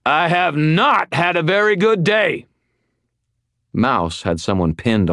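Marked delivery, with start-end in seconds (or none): 0.1-2.5 loud, high furious bellow; roaring outburst, pitched way up. Acted. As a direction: bellow it at the whole room.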